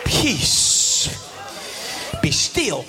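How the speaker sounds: calm command. Acted. Jesus calming the storm.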